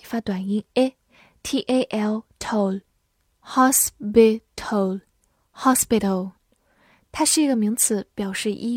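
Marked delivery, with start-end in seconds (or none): 2.4-6.3 "toll" then "hospital" said slowly and clearly; deliberate pronunciation model; slow syllable demo. Shown as a demonstration. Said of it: "H O S发house".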